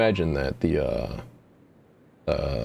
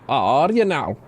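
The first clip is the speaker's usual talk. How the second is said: mock Scottish "Ah, Artie now"; a light attempt at a Scottish accent; bad Scottish accent; 5 semitones higher, 3 dB louder than usual. Shown as a demonstration. Player trying out the Scottish accent, half-hearted. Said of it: "go into, like, a bad Scottish".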